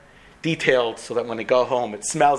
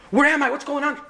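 panicked question; 12 semitones higher than usual. Acted. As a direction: panic as he wakes. Patient panicking in hospital.

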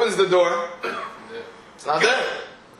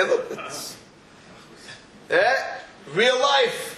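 slow sober warning; 2 semitones higher, much slower than usual. Acted. In-laws tell him to work.

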